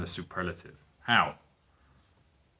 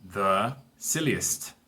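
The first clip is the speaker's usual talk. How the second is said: slow model word; much slower than usual. Shown as a demonstration. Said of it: "El más tonto".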